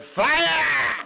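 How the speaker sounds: loud, high yell; yelled alarm. Retold or imitated. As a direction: shout it to empty the room. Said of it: "turned around and yelled".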